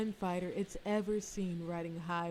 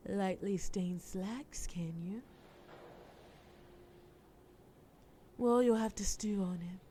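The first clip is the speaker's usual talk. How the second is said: flirty whisper. Acted. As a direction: whisper it close and suggestive. Villain flirting with the detective.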